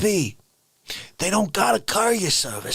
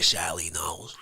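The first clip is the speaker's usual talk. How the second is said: whisper; 14 semitones lower, 6 dB quieter than usual. Acted. Whispered name from chat.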